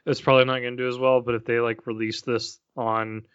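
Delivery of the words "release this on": usual speaking voice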